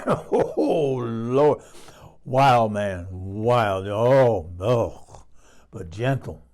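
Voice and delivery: loud, swooning recollection with drawn-out exclamations; flustered, punchy bursts between pauses; worked up. Real, not acted. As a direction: get carried away by a steamy memory. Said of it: "worked up here thinking about it".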